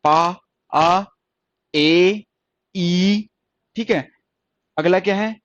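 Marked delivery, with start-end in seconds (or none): 0.1-3.3 पा, आ, ए, ई each drawn out and louder; long vowels listed. Shown as a demonstration. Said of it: "तीन long vowels हुए".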